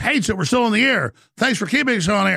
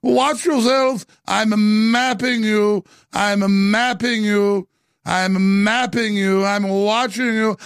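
slow, drawn-out fake British threat; mock posh British menace; 3 semitones higher, slower than usual. Host mocks Gorka with a slow fake British threat. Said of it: "sound like Prince Charles".

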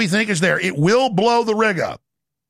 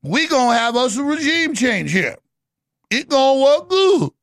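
mock Netanyahu; 4 semitones higher than usual. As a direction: brag it like a reckless boss. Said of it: "And that's Netanyahu".

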